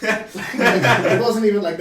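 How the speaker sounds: slow, loud, laughing hard. Real, not acted. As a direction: laugh it out while telling. Laughing through the story.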